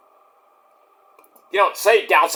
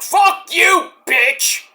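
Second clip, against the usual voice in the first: mock angry yell; 11 semitones higher, much slower than usual. Imitating Trump supporters.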